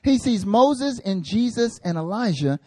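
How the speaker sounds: usual speaking voice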